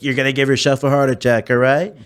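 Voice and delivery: emphatic impersonation, slurring the s; Donald Trump. Retold or imitated. Impersonator to a screaming heckler, emphatic Trump voice. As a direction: talk her down in the politician's voice. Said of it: "I'm not actually Trump".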